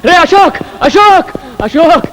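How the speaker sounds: high-pitched hailing of a friend; urgent calling. Acted. Man summoning his friend from a meeting, urgent calling. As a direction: call the name out insistently, pitched well up.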